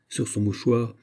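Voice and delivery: usual speaking voice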